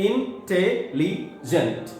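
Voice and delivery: "इंटेलिजेंट" as इन, टे, ली, जेंट with small gaps; slow, separated syllables on "इंटेलिजेंट"; split into syllables. Shown as a demonstration. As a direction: count out "इंटेलिजेंट" slowly in four pieces. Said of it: "चार syllable वाले word".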